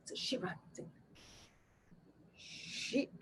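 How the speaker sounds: unvoiced secret whisper of sheetrock; hushed aside on the phone. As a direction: sneak the word past mother. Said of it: "sneak in a little sign from my father".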